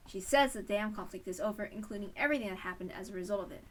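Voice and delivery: ordinary talk, nothing marked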